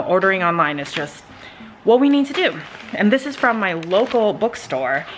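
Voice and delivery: normal talking voice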